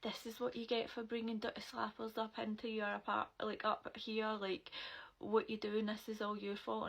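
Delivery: usual speaking voice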